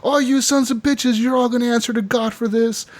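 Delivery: high-pitched imitation of a widow cursing; retold angry outcry. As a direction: curse them in a high, indignant imitation. Grieving widow cursing the men.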